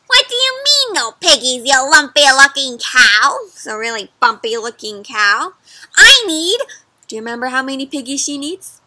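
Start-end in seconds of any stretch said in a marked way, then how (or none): 0.1-3.4 angry witch. Acted. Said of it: "She must be super upset". 6.0-6.6 high, loud witch demand; angry witch. Acted. Witch snapping a demand at the cow, high and loud.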